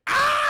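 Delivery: very high, loud shriek; hammed-up scream of agony; mock scream. Tuco screams as his eyes get gouged, mock shriek. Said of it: "And he starts screaming".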